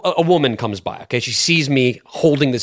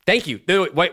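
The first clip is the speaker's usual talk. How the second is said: ordinary talk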